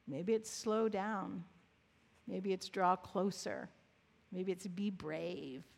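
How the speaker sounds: low, gentle meditation voice with long pauses; guided contemplation. Real, not acted.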